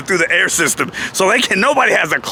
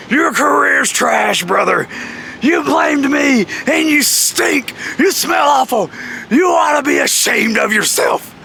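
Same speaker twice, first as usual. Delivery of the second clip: high, slow, drawn-out indignation; mock angry scolding; 9 semitones higher, slower than usual. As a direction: imitate a boss tearing into the culprit. Jeff berating the culprit.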